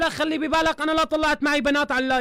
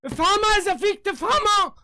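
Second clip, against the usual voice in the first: a real furious tirade hurling insults; furious shouting; 4 semitones higher than usual. Real, not acted. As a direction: shout him down in real fury. Furious rant at a YouTuber.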